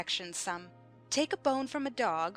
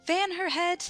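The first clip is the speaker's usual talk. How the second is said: higher, louder command; urgent order; 7 semitones higher, 5 dB louder than usual. Acted. Red Queen ordering Alice fanned, urgent order. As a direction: call out for help at once.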